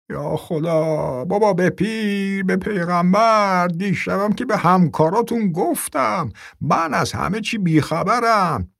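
put-on high, slow, tearful old man pleading; frightened old man. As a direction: beg like a terrified old man.

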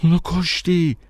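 high-pitched, sharp accusation; accusing. A narrator's reading. Stranger accusing the narrator of killing his beloved, accusingly.

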